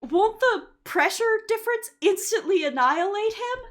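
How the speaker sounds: high, loud retold question; lightly acted, loud, rising question; questioning film character. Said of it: "one of the characters was like".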